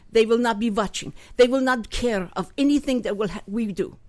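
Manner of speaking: a lightly put-on Russian accent, w as v; Putin impression. Putin orders his generals in a light mock Russian accent. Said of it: "this is how he said it".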